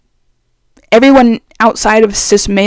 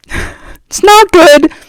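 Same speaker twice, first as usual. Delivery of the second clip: laughing; 8 semitones higher, 6 dB louder, much slower than usual. Real, not acted. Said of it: "my man voice".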